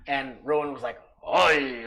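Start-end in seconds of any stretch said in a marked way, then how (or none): 1.3-1.8 put-on loud drawn-out "Oi" in a botched accent; attempted accent. Player attempting the ranger Rowan's accent, loud hammed-up accent. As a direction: have a poor go at another player's accent. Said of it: "I can't do your accent".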